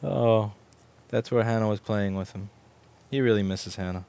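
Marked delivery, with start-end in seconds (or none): none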